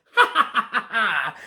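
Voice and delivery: high, hammed-up villain cackle; horrible laugh. A narrator's reading. Wizard mocks the godmother's spell, cackling theatrically. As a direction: cackle wickedly as the storybook wizard. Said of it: "laughed his horrible laugh".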